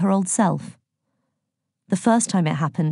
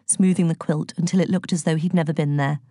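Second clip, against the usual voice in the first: usual speaking voice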